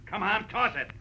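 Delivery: high, loud command; impatient order. Acted. Gunman tells Marlowe to throw gun away, impatient. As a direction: bark it at gunpoint.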